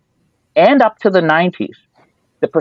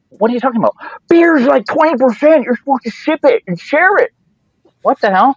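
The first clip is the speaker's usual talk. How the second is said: incredulous high lecturing; 10 semitones higher than usual. Retold or imitated. Friends explaining strong beer.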